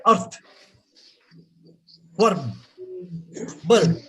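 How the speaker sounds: EARTH, WORM short with sounded R; local pronunciation. Shown as a demonstration. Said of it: "So we say".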